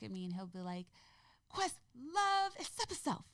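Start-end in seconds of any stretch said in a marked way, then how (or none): 1.5-3.3 put-on very high, little-kid voice; imitating revved-up toddler. Mother imitating her son repeating his affirmation, excited toddler voice. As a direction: mimic a small child excitedly parroting half-known words. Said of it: "he gets so revved up".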